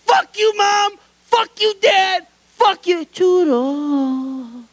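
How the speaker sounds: put-on high, whiny kid cursing his parents; mock bratty yell. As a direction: spit it out like a sulky child. Tail of an acted quote.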